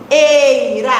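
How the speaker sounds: "Eira" with a long strong "ei"; stressed ending. Shown as a demonstration. Teacher joins the rhyme ending together, drawn out. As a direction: lean on the first vowel of "Eira". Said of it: "aquela vogal mais forte".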